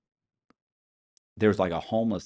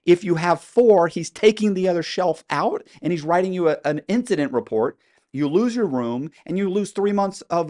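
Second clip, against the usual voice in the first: raised, high-pitched indignant voice; exasperated rant; 7 semitones higher, 5 dB louder than usual. Retold or imitated. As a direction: rant about it, hitting the key words loud. Acted rant contrasting prison with complaint.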